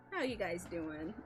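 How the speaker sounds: usual speaking voice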